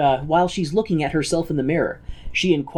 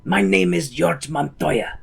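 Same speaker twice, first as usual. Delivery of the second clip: Inigo Montoya voice. Retold or imitated. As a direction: declaim it like a vengeful duelist. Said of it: "In the Inigo Montoya voice".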